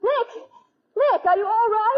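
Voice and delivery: high anxious calling out; wife calling fearfully into the cellar; frightened call. Acted. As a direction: call down, scared for him.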